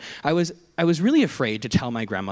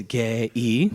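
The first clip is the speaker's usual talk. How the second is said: light Korean accent. Shown as a demonstration. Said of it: "just said with a Korean accent".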